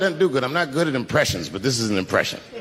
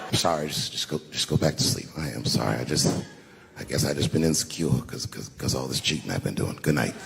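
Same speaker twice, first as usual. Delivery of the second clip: low, soft bedtime murmur; sleepy mock apology; 13 semitones lower, 5 dB quieter than usual. Telling a partner to go back to sleep, sleepy apology. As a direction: mumble a retold apology very low and softly.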